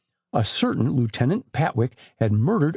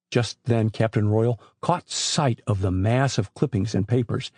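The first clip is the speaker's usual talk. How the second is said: usual speaking voice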